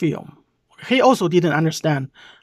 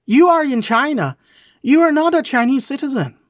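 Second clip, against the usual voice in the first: raised, indignant scolding; heated rebuke; 5 semitones higher than usual. Real, not acted. Vlogger scolding the foreigner in rising anger.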